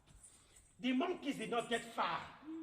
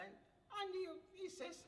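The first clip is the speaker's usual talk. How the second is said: usual speaking voice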